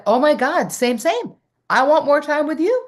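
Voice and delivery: high, loud, gushing delight; squealing excited reply. Retold or imitated. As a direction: burst out with eager joy. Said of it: "I was like".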